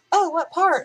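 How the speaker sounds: loud, high, keen question; imitated eager friend. Friend asking which part of England, imitated loud and eager. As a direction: pounce on it in a mimicked high voice.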